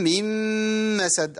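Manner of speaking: two sustained level nasal hums in "من مسد"; double ghunna. Shown as a demonstration. Teacher showing consecutive ghunnas, slow high hummed "من مسد". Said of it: "عندي هنا غنتين ورا بعض".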